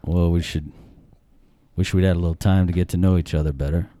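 low, measured dad; her dad's voice. Retold or imitated. Dad answering the suitor by phone, reserved. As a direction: speak low, with reluctant reservation. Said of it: "he goes".

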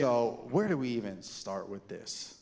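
interviewer voice. Real, not acted. Host turns to formal interview.

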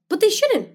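high, forceful "shouldn't"; insist, voice lifted high; emphatic. Real, not acted. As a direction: push back hard on "shouldn't". Emphatic protest.

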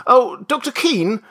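high, loud hail; calling out. A narrator's reading. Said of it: "he called".

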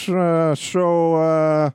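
halting mock impression with stretched fillers; Enzo Amore. Host ending the segment with his impression, halting mock voice. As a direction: drag out each hesitation before a drawn-out greeting. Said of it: "with my Enzo Amore impression".

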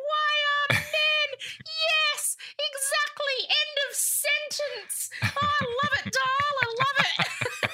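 imitated high, drawn-out shriek through laughter; screaming with delight. Imitating the nurse who loved the line, screaming with laughter. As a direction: squeal it slowly, utterly delighted. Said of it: "She was screaming. She's like".

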